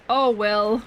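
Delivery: ordinary delivery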